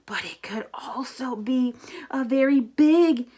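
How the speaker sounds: hushed whisper; spooky whisper. Acted. Grover warning Elmo about the monster, spooky whisper. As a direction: whisper it like a scary secret.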